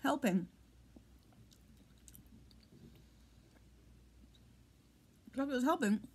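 slurred speech, burning tongue; burned tongue. Real, not acted. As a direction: talk around a scorched tongue. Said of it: "my tongue is still on fire".

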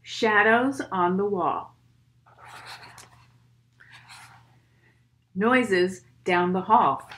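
put-on steady rhythmic poem read for kids. Reads poem aloud to children.

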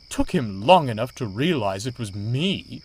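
indignant, emphatic grumble; huffy flounce. A narrator's reading. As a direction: toss it out in a huff. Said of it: "flounced Daisy".

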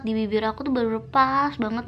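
speaking through sheet face mask; fingers pressing on lips. Real, not acted. Seen 0: Fingertips press sheet mask at lip corners during the words.

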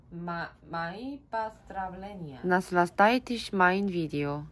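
ordinary delivery

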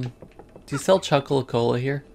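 ordinary talk

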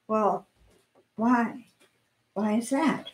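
a shy woman barely daring to ask why; timid little voice. Acted. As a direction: ask it almost under your breath. Timid lady answering the scold.

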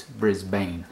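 "Brisbane" as BRIZ-bane, second syllable full; American Brisbane pronunciation. Shown as a demonstration. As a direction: give "Brisbane" a long-a ending like "bane". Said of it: "in the United States they pronounce this".

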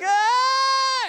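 high, loud imitated baby cry; loud fake baby wail; mock baby wail. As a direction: wail like a hungry toddler. Baby crying in high chair.

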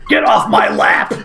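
slow mock yell at a kid; mock shout. Friend jokes he tells his kid off, mock shout. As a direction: bark it at the kid on your lap. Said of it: "but I'll be like".

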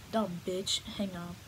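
ordinary talk

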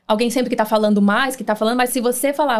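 ordinary talk

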